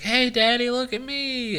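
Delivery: put-on high, eager child calling; a small boy showing off to dad; little kid voice. Kid calling out to his dad across a yard, high and eager. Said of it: "He's like".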